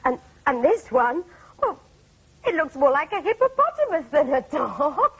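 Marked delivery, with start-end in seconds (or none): 2.4-5.2 laughing through the words; a customer cracking up at a blurry picture. Acted. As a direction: giggle as you describe the silly photo. Customer laughs at funny photos.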